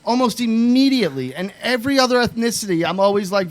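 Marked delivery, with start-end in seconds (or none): none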